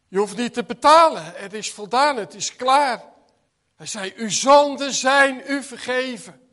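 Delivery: loud, high, fast at first, ringing out; triumphant proclamation. Acted. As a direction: proclaim the debt is paid. Preacher voices the Lord's release. (luid en jubelend verkondigd)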